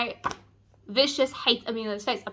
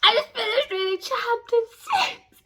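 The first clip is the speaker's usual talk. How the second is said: excited high; 12 semitones higher than usual. Real, not acted. Excited vlog intro to chapter.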